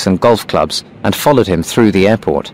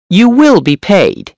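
high, sharp hunchback voice; 11 semitones higher, 3 dB louder, slower than usual. A narrator's reading. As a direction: speak sharp and high, pitched up. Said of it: "The hunchback's voice was sharp and high".

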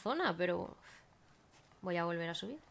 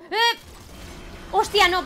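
sudden alarmed shout, higher and louder; surprised shout; 10 semitones higher, 9 dB louder, slower than usual. Real, not acted. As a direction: blurt it out in shock. Monster appears, shouts. (grito de sorpresa)

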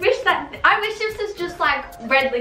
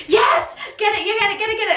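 cheering; 8 semitones higher, faster than usual. Retold or imitated. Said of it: "I was, like, cheering".

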